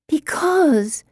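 usual speaking voice

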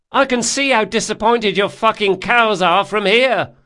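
loud, high parting jeer; taunting shout. A narrator's reading. Girl leaving the galley behind, taunting shout. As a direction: fling one last insult over the water.

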